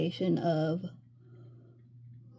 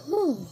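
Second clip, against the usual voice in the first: loud, high winded exhale; breathless whew; 8 semitones higher, 5 dB louder than usual. Real, not acted. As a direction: blow out a tired breath. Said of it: "I don't know why I'm out of breath".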